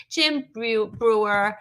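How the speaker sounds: ordinary talk, nothing marked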